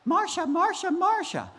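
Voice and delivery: high sing-song calling; high Brady calling Marcia. Acted. Brady Bunch character calls out.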